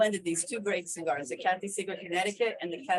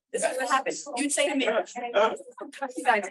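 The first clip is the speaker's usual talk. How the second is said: ordinary delivery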